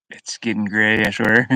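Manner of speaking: ordinary talk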